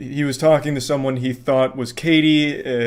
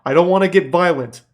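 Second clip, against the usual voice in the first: loud, higher, menacing caller; threatening scammer; 4 semitones higher, 4 dB louder than usual. Retold or imitated. Scam caller threatening him over the phone, louder and higher. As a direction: lean in hard like a phone extortionist. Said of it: "He's like".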